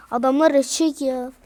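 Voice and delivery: ordinary talk, nothing marked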